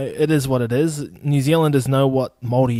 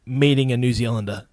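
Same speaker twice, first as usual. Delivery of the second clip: normal talking voice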